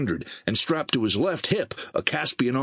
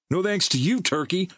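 higher, retorting jab; shot back; 9 semitones higher than usual. A narrator's reading. Merrill retorting to Emerson while walking, higher sharp jab. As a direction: fling it over the shoulder. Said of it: "Merrill shot back".

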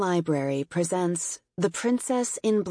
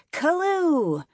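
cheerful cry; 10 semitones higher than usual. A narrator's reading. Victory dance cheer.

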